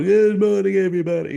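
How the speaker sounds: high, loud fake cheery host voice; bright 90s morning TV host; morning show host. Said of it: "sounds like a nineteen nineties morning show host".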